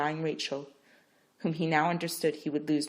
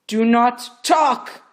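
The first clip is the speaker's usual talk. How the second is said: loud, very slow command; stern order; 8 semitones higher, 14 dB louder, much slower than usual. Acted. Midwife silencing Rachel, stern. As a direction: clamp it down hard.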